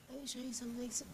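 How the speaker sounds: very soft, high attempt; hushed, high-pitched false start; tentative repeat. Real, not acted. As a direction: trail it out small and high, then break off. Contestant hesitantly repeats lyric, breaks off.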